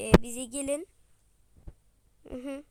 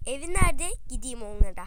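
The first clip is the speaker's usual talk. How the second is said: indignant mother; 8 dB louder, faster than usual. Acted. Mother outraged at the friends.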